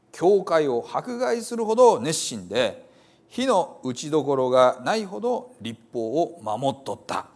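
Hiroshima dialect reading. Acted. Preacher reading Paul's confession to the congregation in Hiroshima dialect. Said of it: "あんまり使わなくなった広島弁ですが".

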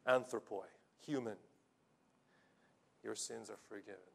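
hushed, soft words of forgiveness; a quiet, tender declaration; gentle pronouncement. Retold or imitated. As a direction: speak it softly as a blessing. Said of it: "he looks all around and he says".

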